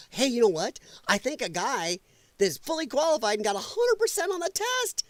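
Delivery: ordinary talk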